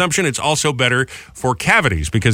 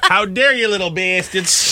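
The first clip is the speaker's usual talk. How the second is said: mock outraged grandma; 6 semitones higher, slower than usual. Voicing grandma again.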